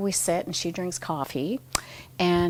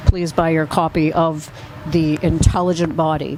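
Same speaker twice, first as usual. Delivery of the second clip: louder, playful advertisement voice; mock ad plug; 2 semitones lower, 8 dB louder than usual. Shown as a demonstration. Scene: plugging her own book to listeners, mock ad voice. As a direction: pitch the book like a cheesy ad. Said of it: "Little advertisement".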